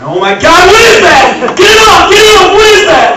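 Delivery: shrieking high, get it off; frantic scream like a spider landed on him; panicked freakout. Pretend. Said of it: "They'd come up to me and say".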